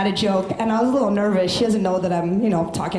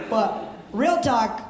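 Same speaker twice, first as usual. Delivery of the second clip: usual speaking voice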